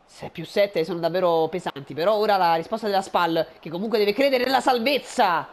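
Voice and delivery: usual speaking voice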